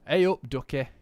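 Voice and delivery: loud, hammed-up Nottingham "Hey up, ducky"; Nottingham greeting. Streamer showing off a Nottingham accent to chat, loud and hammed-up. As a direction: belt out a broad East Midlands hello. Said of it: "my accent".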